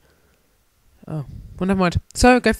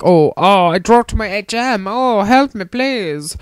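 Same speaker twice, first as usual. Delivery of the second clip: NPC voice; 6 semitones higher, 5 dB louder, slower than usual. Retold or imitated. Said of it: "and he's like".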